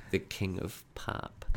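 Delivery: whispered, breathy and close; ASMR whisper. Pretend. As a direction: whisper it right into the mic. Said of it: "this is an A S M R podcast".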